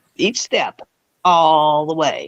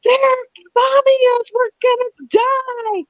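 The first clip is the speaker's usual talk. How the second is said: imitating panicked kids, much higher; high frightened wail, slow at the end; hammed-up terrified children on the phone; mock hysterical kids. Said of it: "hysterical on the phone, screaming, crying".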